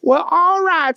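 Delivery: high, goofy voice; squeaky simple-minded fellow; chirpy dimwit voice; Booger voice. A narrator's reading. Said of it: "said Booger".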